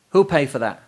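irate tradesmen shouting after a culprit; growled demand. A narrator's reading. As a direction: call out an angry demand for payment. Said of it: "growled the painters".

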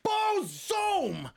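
high triumphant Boom; excited. Pretend. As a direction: pop it out triumphantly. Recipe reveal.

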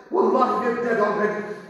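ordinary talk, nothing marked

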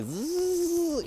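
high buzzing plane-on-the-runway noise; imitated plane engine. Vlogger imitates plane noise he heard, high buzzing. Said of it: "like a plane on the runway".

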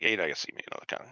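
hurried quiet mutter; muttered aside. Retold or imitated. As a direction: rush it under your breath, resigned. Bitter inner aside.

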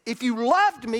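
loud, high, furious cry to God; retold shouting at God, anguished; angry shout at God. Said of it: "God said / So I said".

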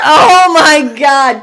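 loud, slow, drawn-out amazement; shocked exclamation. Real, not acted. Listener reacting to the uncle's encounter, loud drawn-out shock. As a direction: stretch it out in loud disbelief.

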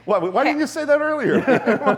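high, loud laughing question. Real, not acted. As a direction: laugh through the words. Laughing at cocaine comparison.

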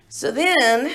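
ordinary talk

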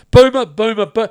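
usual speaking voice

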